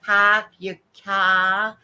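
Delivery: put-on slow Boston accent. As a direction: drop the r's, Boston style. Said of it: "Boston accent".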